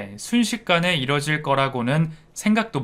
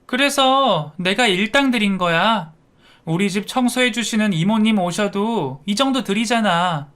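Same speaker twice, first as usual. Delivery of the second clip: higher-pitched, defiant protest; brazen self-justifying; 6 semitones higher than usual. Acted. Daughter-in-law defending the cash payment to her husband, brazenly. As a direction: justify yourself as if you did nothing wrong. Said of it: "오히려 뻔뻔하게 말하더라고요".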